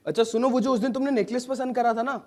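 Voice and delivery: usual speaking voice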